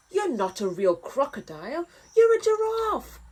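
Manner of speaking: mean crocodile snap. Acted. Crocodile rejecting the giraffe, snapping meanly. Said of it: "snapped a mean-looking crocodile".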